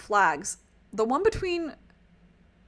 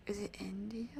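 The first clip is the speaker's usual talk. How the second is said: whisper; 14 dB quieter than usual. Real, not acted. Unsure aside while reading flags.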